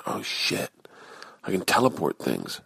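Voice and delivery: awed hush. Retold or imitated. Amazed at the teleporter.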